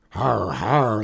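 gruff spoken "har har"; mock laughter. A narrator's reading. Outlaw boss laughing at his beaten men, mock guffaw. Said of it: "laughed Hogbone".

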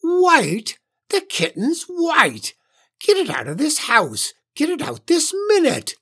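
very high, outraged screech; shrill, angry witch. A narrator's reading. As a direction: screech the orders, voice shooting up high. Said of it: "Her voice rose higher and higher".

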